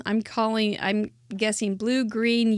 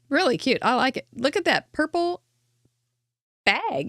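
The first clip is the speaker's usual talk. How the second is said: normal talking voice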